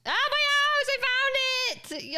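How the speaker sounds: high, drawn-out squeal of joy; excited shout. Real, not acted. Streamer finding her lost house, high excited squeal. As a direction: shriek it out, thrilled to find it.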